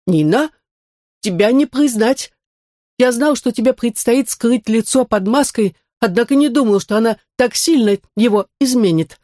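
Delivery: lightly voiced android. Android Max speaks. (голос андроида)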